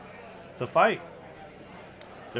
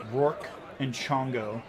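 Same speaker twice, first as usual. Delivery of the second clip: ordinary talk, nothing marked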